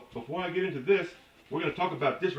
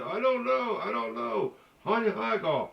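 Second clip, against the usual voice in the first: mimicked tipsy woman; 8 semitones higher than usual. Drunk woman admits she knows nothing, mimicked high. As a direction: draw it out in a high put-on voice.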